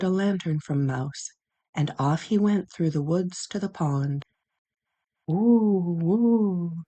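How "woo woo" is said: long swooping "woo" like wind; airy wind howl, rising and falling. A narrator's reading. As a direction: imitate the wind in drawn-out hoots. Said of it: "the wind blew in the trees".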